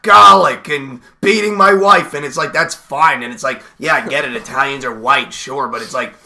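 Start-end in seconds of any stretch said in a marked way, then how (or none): 0.0-2.1 hammed-up Italian-American tough guy; over-the-top Italian-American accent, rising to a shout; mock Italian-American accent. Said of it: "you could do a Guinea accent all day".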